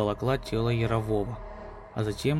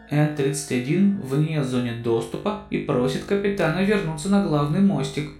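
loud, higher robotic ship announcer; computer voice; 4 semitones higher, 6 dB louder than usual. Acted.

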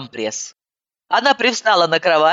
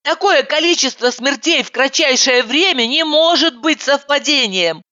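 insistent; 9 semitones higher than usual. Acted. Insistent high argument.

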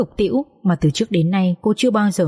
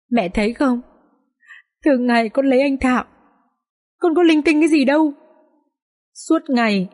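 choked with grief; 4 semitones higher than usual. A narrator's reading. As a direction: pour out hurt to mother-in-law, close to tears. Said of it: "cô nói trông uất nghẹn". (giọng uất nghẹn, cao và chậm, sắp khóc)

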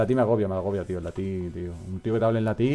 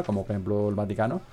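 ordinary talk

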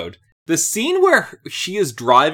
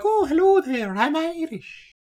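high, lilting mock-Irish greeting; lyrical Irish. Showing how lyrical Irish sounds beside Scottish, lilting Irish accent. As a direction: put on a singsong, high-pitched Irish brogue. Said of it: "it's much more lyrical".

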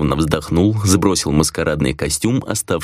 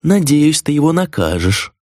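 higher-pitched insinuating tempter; lightly voiced tempter egging her on; sly prompting, pitched up; angel tempter; 10 semitones higher than usual. A narrator's reading. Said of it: "голосом ангела-искусителя продолжил Миклаш".

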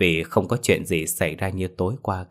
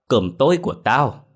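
higher, louder mock wail over the lost dinner; hammed-up wail, higher and louder; wailing lament; 6 semitones higher, 4 dB louder than usual. A narrator's reading. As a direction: whine it out like a hammed-up loss.

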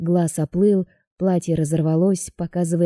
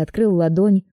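usual speaking voice

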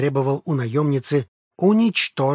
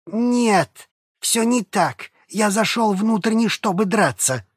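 high pained protest; 7 semitones higher, slower than usual. Acted. Flo protests in pain.

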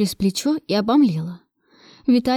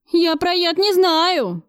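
high, drawn-out panicky denial; acted wail; 9 semitones higher, much slower than usual. A narrator's reading. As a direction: wail your innocence slowly. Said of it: "взвыл Федоркин". (отчаянный жалобный вой)

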